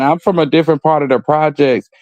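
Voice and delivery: ordinary talk, nothing marked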